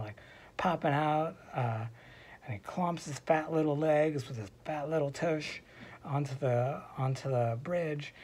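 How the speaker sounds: usual speaking voice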